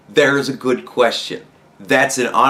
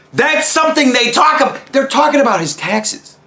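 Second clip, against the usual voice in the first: much higher, louder, shouting in disbelief; exasperated rant; 10 semitones higher, 3 dB louder than usual. Real, not acted. Vlogger raging at news covering taxes, shouting higher. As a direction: boil over at the coverage. Ranting about media ignoring story.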